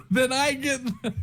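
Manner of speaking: high-pitched squeal of retreat; mock-frightened high voice; comic panic. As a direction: jump up high, playfully fleeing. Player jokes about fleeing the room.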